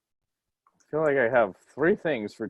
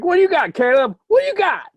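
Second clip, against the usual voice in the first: high, loud mocking taunt; 6 dB louder than usual. Fighter taunting his opponent, high and loud. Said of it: "It's like".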